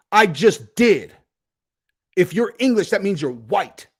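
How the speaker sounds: acted defiant comeback.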